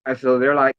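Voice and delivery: ordinary delivery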